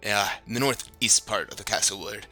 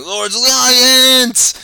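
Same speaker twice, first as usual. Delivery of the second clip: high, loud, slowed mock shout; imitating a yell; 13 dB louder, much slower than usual. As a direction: echo the player's bellow back. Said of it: "You're just yelling".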